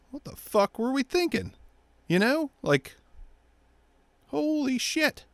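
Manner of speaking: high incredulous what the fuck; disbelief. Real, not acted. Ranting at viewers, high incredulous disgust. Disdain rant.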